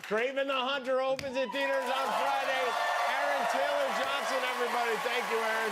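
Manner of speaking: high, projected talk-show outro; upbeat plug into the break; show host sign-off. Real, not acted. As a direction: announce the guest over applause. Host's outro over applause.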